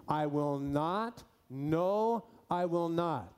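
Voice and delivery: slow "I will not, no, I will not." with each "not" leaned on hard; emphatic double negative. Shown as a demonstration. Preacher reading the Greek aloud to his church, slow and emphatic. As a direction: spell out "I will not" slowly, stressing "not" both times. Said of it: "That's the way it would read".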